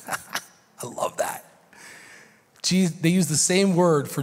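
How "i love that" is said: soft unvoiced laugh into a whisper; a hushed, laughing aside. Real, not acted. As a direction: breathe it out in delight. Breathy aside after reading.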